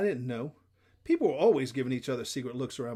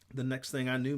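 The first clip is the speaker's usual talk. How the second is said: usual speaking voice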